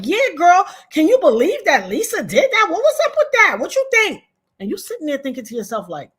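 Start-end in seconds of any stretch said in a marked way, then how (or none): 0.0-4.2 high, loud gossipy phone voice; animated mock girlfriend chatter. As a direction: mimic a friend eagerly fishing for agreement. Acting out friend calling back for gossip.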